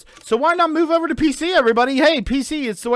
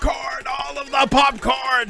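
mock heroic shout. Hammed-up shout over the music.

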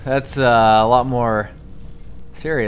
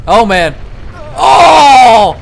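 shout; 12 semitones higher, 13 dB louder, much slower than usual. Real, not acted. Dismay in game.

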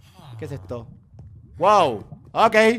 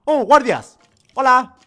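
startled; 4 semitones higher, 8 dB louder, much slower than usual. Real, not acted. Guards appear.

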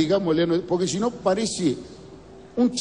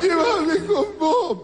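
much higher, very slow wail breaking into a laugh; shrieking mock lament, higher and drawn out; over-the-top wailing widow; 10 semitones higher, much slower than usual. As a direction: overplay the grieving old woman begging to go along. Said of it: "Lloraba la vieja".

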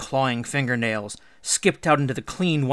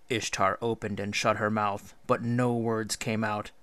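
usual speaking voice

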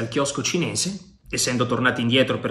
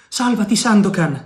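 higher, louder cry of warning; shouted warning; 6 semitones higher, 4 dB louder than usual. Acted. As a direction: call out to save him. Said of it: "si udì a gridare Marianna".

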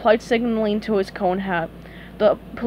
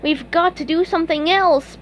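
higher, determined declaration; declaring; 6 semitones higher than usual. Acted. SpongeBob tells Patrick they must change plans, declaring. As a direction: declare it with resolve. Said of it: "SpongeBob declared".

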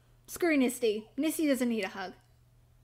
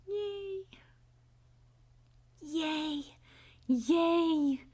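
Fluttershy; 2 semitones higher, much slower than usual. Acted. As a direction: hold a tiny, gentle cheer. Fluttershy yay imitation.

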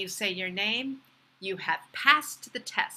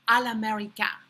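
"à l'américain" in a French accent; French phrase; 4 dB louder, faster than usual. Shown as a demonstration. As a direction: switch into French pronunciation for "à l'américain".

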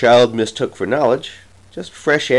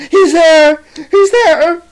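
put-on piercing high, loud child's scream; panicked cry; 8 dB louder, slower than usual. A narrator's reading. Jane cries again to her parents, panicked high cry. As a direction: shriek it out in sheer terror. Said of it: "again she cries".

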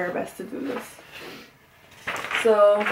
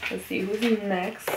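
ordinary talk

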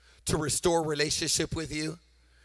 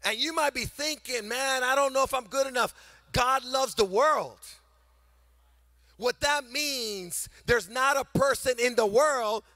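louder, rising preacher's build with long pauses; full-force preaching; 5 semitones higher, 6 dB louder than usual. Acted. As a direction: preach it to the whole room, building.

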